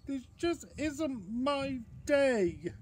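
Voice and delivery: slow, high, loud lament; despairing bear. Acted. Bear gives up on food, high slow despair. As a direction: wail it slowly in a high storybook voice.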